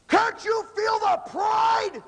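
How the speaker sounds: high, loud, drawn-out mock scream; imitated senior scream. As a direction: bellow it slowly like a senior berating freshmen. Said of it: "I watch seniors scream at freshmen".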